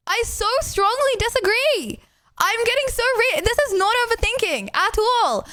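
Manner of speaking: high, fired-up protest; heated disagreement. Real, not acted. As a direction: push back, worked up.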